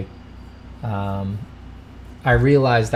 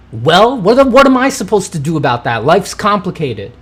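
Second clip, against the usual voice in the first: higher, louder exasperated protest; exasperated outburst; 7 semitones higher, 8 dB louder than usual. Acted. Streamer protesting that his scowl looks sarcastic, exasperated. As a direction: throw up your hands, louder and higher.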